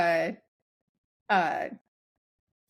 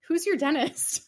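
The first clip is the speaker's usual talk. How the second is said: chirpy question pitched high; high eager question; 7 semitones higher than usual. Retold or imitated. Almost asking the officer who his dentist is, high and eager. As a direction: blurt it brightly, as if you can't help asking. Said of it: "So I almost said".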